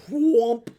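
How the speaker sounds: voiced cartoon sound effect; imitated magic whoosh. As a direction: mimic a big engulfing whoosh. Imitates the magic engulfing him.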